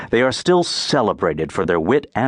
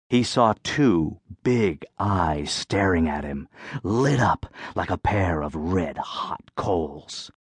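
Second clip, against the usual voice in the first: softer, lower and slow; hushed suspense; 6 semitones lower, 6 dB quieter, slower than usual. A narrator's reading. Narrator reaching the scary eyes in the thicket, hushed. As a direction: draw them in like a ghost story.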